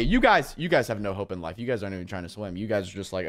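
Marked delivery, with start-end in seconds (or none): none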